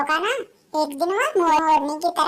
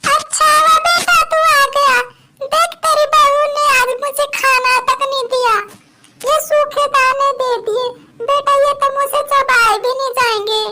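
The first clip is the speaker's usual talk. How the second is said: tearful complaint; 8 semitones higher, 8 dB louder than usual. Acted. Old mother complains to son.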